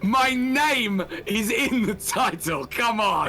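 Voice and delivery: mock outrage. Mock outrage over the title.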